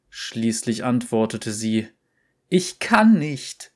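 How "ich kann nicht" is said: slow, high, loud cry. A narrator's reading. Exhausted girl cries out to the voice, high and loud. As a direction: shout it out from exhaustion, higher and slower. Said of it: "schrie sie".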